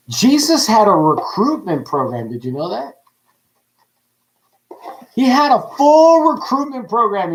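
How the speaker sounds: ordinary talk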